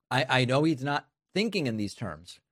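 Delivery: ordinary delivery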